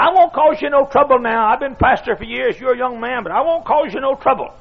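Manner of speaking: put-on high, earnest old man; squeaky old voice. Old preacher reassuring the young pastor, squeaky. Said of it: "In a squeaky old voice".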